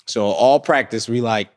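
ordinary talk

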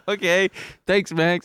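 high, awkward relieved thanks; nervous thanks. Retold or imitated. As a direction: squeak out a rattled thank-you. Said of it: "And I was just like".

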